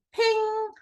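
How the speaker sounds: high bright ping sound; a sharp high ping like a notification. A narrator's reading. Sound effect in her head.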